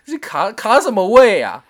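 loud, slow, incredulous retort; indignant scoff. Real, not acted. Scoffing at the salesman's save-your-spot pitch, loud and slow. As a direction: scoff at the absurd pitch, slowly and loudly.

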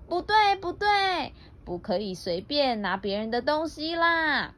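high-pitched scolding call, easing off; lightly acted rabbits' outcry; mild shouting. Rabbits objecting to the bear taking things, mild shout. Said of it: "又大叫了".

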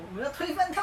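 a hint of a heroic rebel shout; rally the troops, lightly hammed; mock rebel cry. Vowing to overthrow the big sister, mock-heroic. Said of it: "大姐姐想用暴政统治我".

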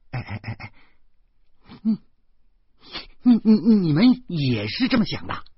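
stammering disbelief, jumping up high; greatly startled. A narrator's reading. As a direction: stammer in shock, then burst into the question. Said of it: "石谦儿大惊". (大惊失色，结结巴巴)

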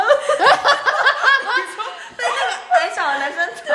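high, slow, laughing in disbelief; incredulous laugh. Real, not acted. Friend asks if the short boy hit her, laughing incredulously. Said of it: "不可能".

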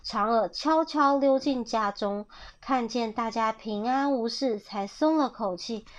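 ordinary delivery

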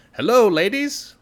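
high, loud and slow, a grand showman's hello; Pooka's sly greeting. Acted. The Pooka greets a roomful of banshees in a high, drawn-out voice. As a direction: sweep in like a charming trickster spirit.

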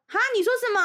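high, loud call from the bathroom. Retold or imitated. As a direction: shout through a closed door. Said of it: "从里面喊出来说".

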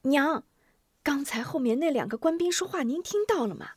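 put-on high, insistent child voice; clamouring boy. Boy grabs mother to ask, high insistent clamour. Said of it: "嚷嚷道".

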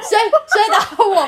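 high, loud laughing mimic of a dazed man; dazed boyfriend. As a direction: mimic him waking up confused, laughing. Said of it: "说". (笑着高声模仿刚被砸醒的男友)

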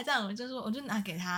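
usual speaking voice

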